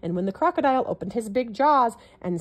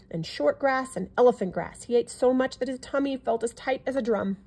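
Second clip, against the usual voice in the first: usual speaking voice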